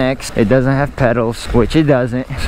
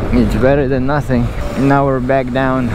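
ordinary talk, nothing marked

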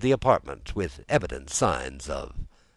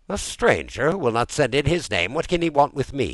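higher, louder incredulous question; puzzled echo; 8 semitones higher, 5 dB louder than usual. A narrator's reading. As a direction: repeat the news in disbelief. Master echoes valet in disbelief.

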